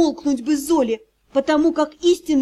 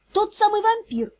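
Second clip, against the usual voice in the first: plain reading voice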